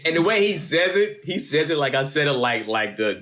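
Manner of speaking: ordinary talk, nothing marked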